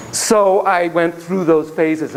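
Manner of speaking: ordinary talk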